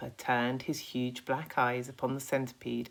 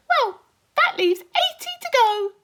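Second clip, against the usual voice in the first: much higher, louder cocky insect voice; a boastful little bug, pitched way up; Centipede voice; 12 dB louder than usual. Acted.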